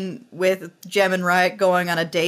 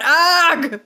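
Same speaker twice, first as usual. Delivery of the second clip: loud, high mock "ugh"; Charlie Brown groan; 8 semitones higher, 4 dB louder than usual. Podcaster imitating a comic panel moment, high exasperated groan. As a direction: burst out in cartoon exasperation. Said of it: "like, Charlie Brown".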